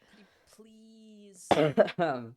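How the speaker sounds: high, slow, hammed-up begging; drawn-out plea. Streamer begging viewer Kiki, hammed-up drawn-out pleading.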